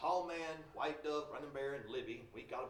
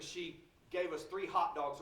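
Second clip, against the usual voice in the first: ordinary talk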